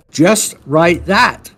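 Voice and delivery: slow, deliberate coach's order; slow firm instruction. Retold or imitated. Coach repeating his order slowly to a confused client, firm and deliberate. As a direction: spell it out slowly like a patient coach.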